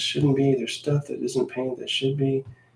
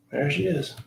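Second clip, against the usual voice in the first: usual speaking voice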